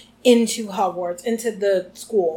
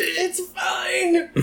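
strained, pitched-up, whimpering fine; pained Hermione; 8 semitones higher than usual. Retold or imitated. Hermione, rubbing her neck, claiming she's fine. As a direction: force out a brave little fine. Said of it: "She's like".

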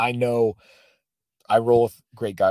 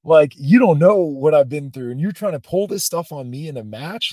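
high, heated telling-off; louder, indignant retold rant; imagined angry outburst; 5 semitones higher, 6 dB louder than usual. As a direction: let him have it, as imagined. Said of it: "I wanted to slug him in the face".

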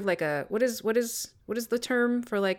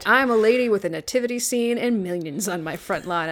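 usual speaking voice

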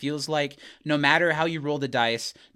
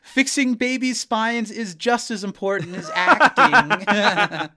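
high, raised call, drawn out at the end; calling after him; 8 semitones higher, 6 dB louder, slower than usual. Retold or imitated. Tahani calling after Larry as he rushes off, calling out. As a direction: call it out high, stretching the last word. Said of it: "Tahani calls off".

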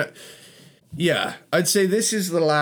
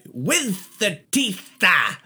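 slow growly metal singer drawl; 7 semitones higher, much slower than usual. Retold or imitated. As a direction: stretch it like a metal frontman. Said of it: "[name] doing a [name] impression".

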